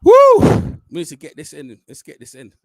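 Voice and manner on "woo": 2 high, loud cheering whoop; 3 let out a big celebratory whoop. Real, not acted. 4 fan hyping up the shootout, whooping loudly.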